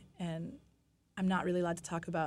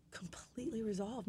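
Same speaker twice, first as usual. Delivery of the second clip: whispering; 3 semitones higher, 6 dB quieter than usual. Real, not acted. Telling of the scans coming back clear.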